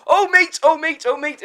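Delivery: retold call, loud and high; calling after him. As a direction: shout mate after a fleeing conman. Chasing the dealer, calling out.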